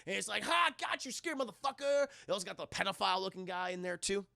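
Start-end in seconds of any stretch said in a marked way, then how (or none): 0.4-2.1 high-pitched mock gloat; gloating taunt. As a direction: crow over someone you just trapped. Retold gloating at trapped killer.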